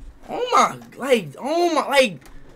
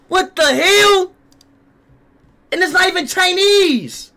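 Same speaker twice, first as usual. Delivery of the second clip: outraged disbelief; 7 semitones higher than usual. Real, not acted.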